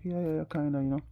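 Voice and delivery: high-pitched chatty impression; mock agreeing woman. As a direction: imitate her quick, eager agreeing. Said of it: "And she's like".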